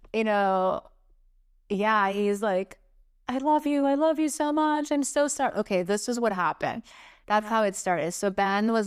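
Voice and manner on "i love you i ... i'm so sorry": high, whimpering imitation of a crying partner; mock weepy, pitched-up voice; imitated tearful apology. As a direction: imitate someone pleading through tears. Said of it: "he just started crying like crazy".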